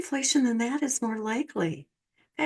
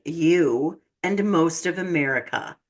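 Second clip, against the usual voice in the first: usual speaking voice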